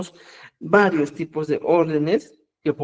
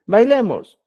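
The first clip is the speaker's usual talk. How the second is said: Bailemos said louder, falling firmly, as a call to all; emphatic repeat; 5 semitones higher, 5 dB louder than usual. Shown as a demonstration. Teacher repeats the word louder to drive it home. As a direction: stress Bailemos as an invitation. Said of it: "¿Ya?".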